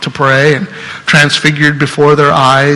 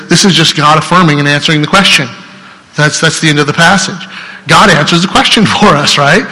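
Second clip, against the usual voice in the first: usual speaking voice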